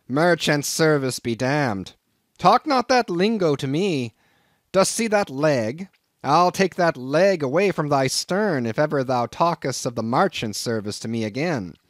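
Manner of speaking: put-on old captain scolding the greenhorn over his merchant service. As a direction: bluster like an offended old whaleman. Old captain explodes at Ishmael.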